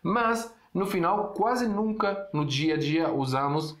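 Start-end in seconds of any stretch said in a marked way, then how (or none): none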